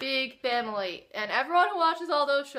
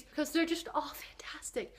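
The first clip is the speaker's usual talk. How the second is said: whisper; 3 semitones higher, 7 dB quieter than usual. Real, not acted. Whispered aside while gushing.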